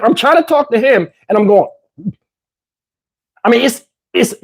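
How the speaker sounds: ordinary talk, nothing marked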